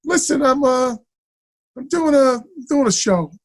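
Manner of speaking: offhand, high-pitched imitation; Chazz. Actor mentioning his show to former student, imitated downplaying. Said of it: "he downplays everything".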